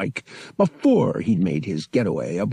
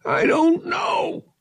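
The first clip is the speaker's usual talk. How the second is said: very high, drawn-out answer; pressured cousin Thraken; much slower than usual. Acted. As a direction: plead ignorance in a raised voice.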